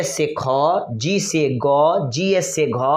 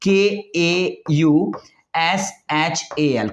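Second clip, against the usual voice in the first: letters spelled out slowly one by one; slow spelling; much slower than usual. Shown as a demonstration. Teacher spelling a name letter by letter, slow. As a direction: read each letter slowly and separately. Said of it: "हम लोग कौशल ऐसे लिखते हैं".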